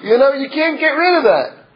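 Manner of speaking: normal talking voice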